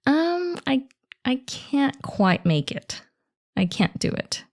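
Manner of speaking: hesitant high-pitched start, slow and tentative. Shown as a demonstration. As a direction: let them down gently, drawing out the excuse. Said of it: "a softer, sort of gentler way".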